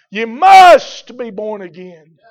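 loud, slow bellow with "must" hit hard; imitating George Whitefield. Preacher imitating the great preacher of yesteryear, slow bellow. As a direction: bellow it out as a pulpit truth. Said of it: "he would bellow out that truth".

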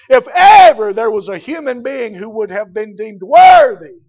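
loud pulpit delivery with hard-hit openings; full-force preaching. Acted. Preacher extolling John the Baptist to the congregation, full-force preaching.

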